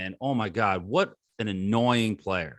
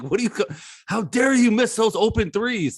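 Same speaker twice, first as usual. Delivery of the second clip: mock outrage; 9 semitones higher, 5 dB louder than usual. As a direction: ham up an indignant fan's complaint. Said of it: "I'm like".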